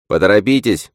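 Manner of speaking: insistent call; urging. A narrator's reading. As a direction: hurry them along firmly. Old woman urges swimmers on.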